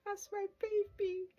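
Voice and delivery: overwhelmed, voice breaking into tears; crying with joy. Real, not acted. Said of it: "I was like".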